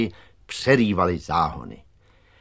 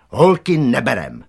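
loud, raised dismissive call; 4 semitones higher, 8 dB louder than usual. A narrator's reading. Boy chief to the offended girl walking away, called out. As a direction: call a firm refusal after someone leaving. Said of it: "zvolal ještě".

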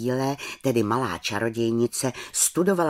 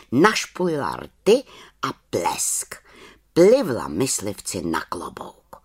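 ordinary delivery, nothing marked

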